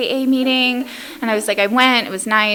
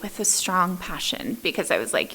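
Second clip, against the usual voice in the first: usual speaking voice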